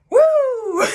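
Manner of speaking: high whoop into a squealing laugh; real delighted whoop then giggle; excited whoop and laugh. Real, not acted. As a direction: let out a loud, high woo and laugh. Hyped reaction to lyric.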